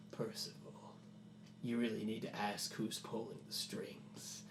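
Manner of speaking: soft, menacing, whispery voice; sinister trapped villain. Acted. Trapped creature tells Percival it still influences him, sinister. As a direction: speak softly, gloating over the fool.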